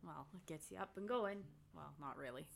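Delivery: usual speaking voice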